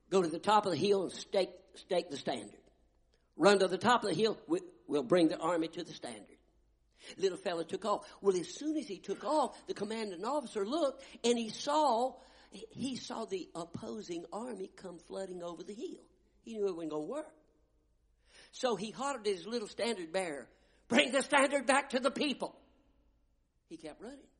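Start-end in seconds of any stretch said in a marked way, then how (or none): 0.1-6.4 commander's order. Retold or imitated. Commander ordering flag bearer. 20.9-22.6 hollered order. Retold or imitated. Commander hollers at bearer.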